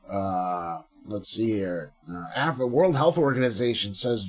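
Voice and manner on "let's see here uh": normal talking voice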